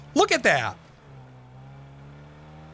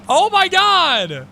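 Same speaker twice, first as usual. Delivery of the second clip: slow, louder, higher disbelieving cry; drawn-out exclamation; 8 semitones higher, 5 dB louder, much slower than usual. Real, not acted. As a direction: stretch it out in exasperated disbelief. Reacting to montage of himself.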